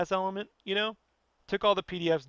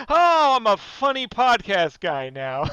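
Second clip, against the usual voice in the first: loud, slow, higher-pitched funny-guy parody; mock podcast voice; 5 semitones higher, 9 dB louder, slower than usual. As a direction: ham it up as a smug podcast comedian. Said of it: "let's put on the podcast voice".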